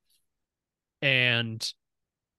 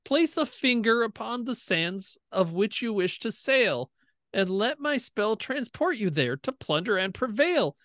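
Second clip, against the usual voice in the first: inscription recital; 8 semitones higher than usual. Acted. Reading magic inscription rhyme.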